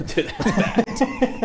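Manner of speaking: talking through real laughter; amused, laughing disbelief. Real, not acted. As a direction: let the laugh break into the words. Laughing over the failed accent.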